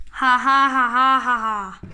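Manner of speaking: low villain chuckle; evil monster laugh. Acted. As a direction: laugh like a gloating beast. Giant laughs.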